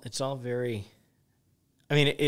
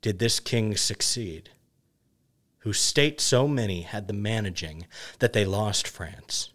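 measured, declaimed Shakespearean chorus with swelling opening phrases; dramatic verse reading; 4 dB louder, slower than usual. Acted. Reader delivering the epilogue as the Chorus, dramatic verse reading. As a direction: declaim the verse grandly, pausing between lines.

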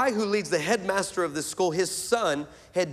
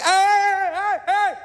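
calling a halt; 13 semitones higher, 5 dB louder than usual. Retold or imitated. Preacher interrupting a stranger's barrage of questions, loud high protest. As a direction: cut in loudly, pitch way up.

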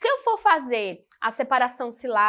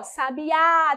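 "Sabiá" with a long held Á; final-syllable stress; 2 semitones higher than usual. Shown as a demonstration. Teacher pointing out the accent, "Sabiá" held on Á. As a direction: stretch the last syllable of "Sabiá". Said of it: "a sílaba tônica de sabiá é a última".

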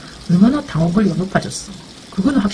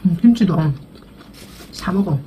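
chewing between words; muffled speech around food; mouth full, chewing. Real, not acted. Seen 1: cheeks bulged, jaw working mid-chew during mukbang speech.

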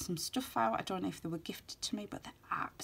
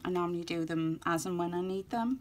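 ordinary talk

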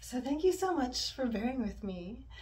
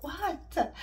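snapping; 6 semitones higher, 3 dB louder than usual. Retold or imitated. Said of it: "because I snapped at him".

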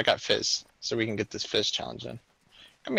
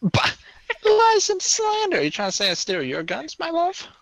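mock indignation; 8 dB louder than usual. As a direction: play the wrongly accused. Accused of stealing guns.

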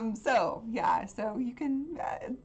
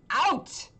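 shouted order; 7 semitones higher, 5 dB louder than usual. Acted. Chemist throws player out.

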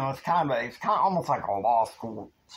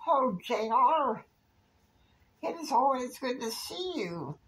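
happy Kristen; 12 semitones higher than usual. Acted. Kristen greets J R at her dorm door, happy high voice. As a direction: put on a high, happy woman's voice. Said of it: "she's so happy, she's like".

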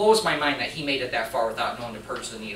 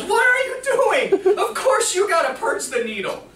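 high, loud, slow rebuke with a laugh; head nurse scolding; 7 dB louder, slower than usual. Retold or imitated. Head nurse scolding the new nurse, loud high rebuke. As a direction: imitate the head nurse tearing into him. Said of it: "she goes".

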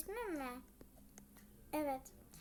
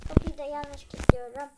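much louder, exasperated; frustrated outburst; 14 dB louder than usual. Acted. Locking the door wrong, a loud frustrated outburst. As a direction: blurt it out, fed up.

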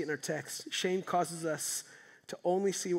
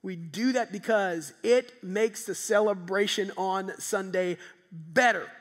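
normal talking voice

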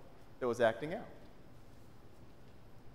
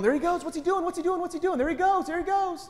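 frantic high-pitched yell, much higher and louder; panicked yelling; 5 dB louder than usual. Retold or imitated. A friend's mom panicking at a hockey game as the goalie leaves, frantic high yell. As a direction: shriek it like a spectator who thinks disaster struck. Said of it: "She stands up and yelling".

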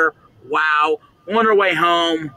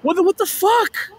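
very high, faltering alarmed exclamation; panicked disbelief; 14 semitones higher than usual. Real, not acted. Left alone in the dark, panicked. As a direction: stammer in fright, pitch shooting up.

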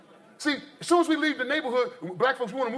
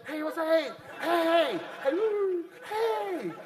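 high, softened, ingratiating hellos; put-on eager dog begging for adoption; fake-friendly pound dog; 7 semitones higher, much slower than usual. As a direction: coo sweetly, pitching each hey higher. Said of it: "they fake it".

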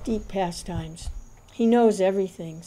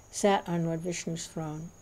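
ordinary delivery

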